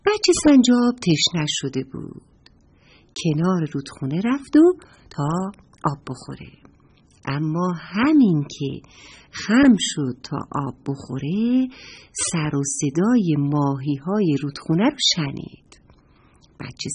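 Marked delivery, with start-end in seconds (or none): none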